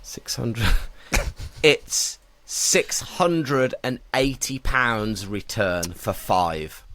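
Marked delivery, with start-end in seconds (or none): 1.6-6.8 slow, dramatic price reveal. Real, not acted. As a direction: draw out the answer slowly. Revealing the quiz answer.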